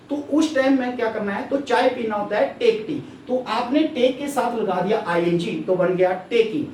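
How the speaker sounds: normal talking voice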